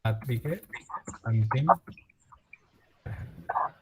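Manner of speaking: soft unvoiced whisper. Real, not acted. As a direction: breathe the words without voice. Teacher repeats the answer softly.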